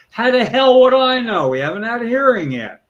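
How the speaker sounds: loud, high-pitched indignant retort; exasperated senator. Acted. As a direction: throw it back, loud and exasperated. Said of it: "their correct answer would be".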